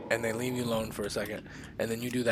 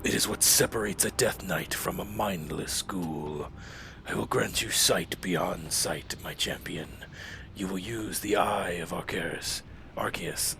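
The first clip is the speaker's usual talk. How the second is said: Lich King voice; 4 semitones lower than usual. Acted. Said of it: "I'm trying to get the Lich King voice back".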